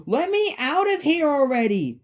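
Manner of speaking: high, loud desperate yell; screaming. Acted. Abby trapped behind the locked door, screaming. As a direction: scream to be let out. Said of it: "she's screaming".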